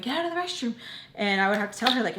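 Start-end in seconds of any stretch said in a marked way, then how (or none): 0.0-0.7 mom's mock yell. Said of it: "my mom being like".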